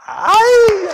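high playful yelp; sudden high mock ay. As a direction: burst out with a high mock cry. Exclamation after silent round.

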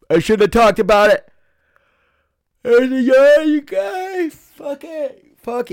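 words stretched high through a yawn. Real, not acted. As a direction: talk through a big yawn. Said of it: "I'm struggling with the yawns today".

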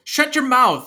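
loud, high-pitched order; bossy kid. Acted. Kid orders clown uncle around, bossy and loud. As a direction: cut him off like a bratty kid.

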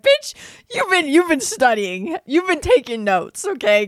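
high, loud mock callout; teasing him for studying her posts; sassy callout. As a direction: call him out with sass, pitch way up. Mock scolding the guy in retelling.

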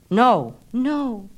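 high, loud no no; loud refusal. Acted. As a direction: push her off with the voice.

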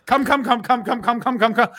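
high, rapid repetitive chant; mock an impatient listener, high-pitched; hammed-up high pleading chant; imagined eager listener. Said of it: "This is what they are".

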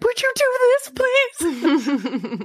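high-pitched mock plea to a husband; pleading. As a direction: beg in a squeaky-high voice, laughing by the end. Acts out her plea to husband.

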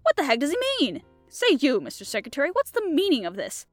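high, loud outburst; indignant demand. A narrator's reading. Ditchling confronts Mister Secretary, indignant demand. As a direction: demand an explanation from the culprit.

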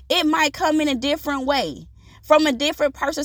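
normal talking voice

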